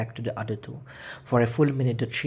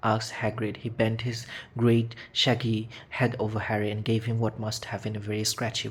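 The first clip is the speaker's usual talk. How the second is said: plain reading voice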